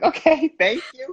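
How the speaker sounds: high, slow laughing thanks; mock grateful, laughing high; laughing sarcastic thanks. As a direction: thank her while cracking up. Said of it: "I'm like".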